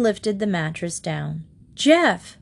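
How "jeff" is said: higher, louder call into the van; mother calling her son's name. A narrator's reading. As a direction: call out for the lost boy. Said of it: "Mother called".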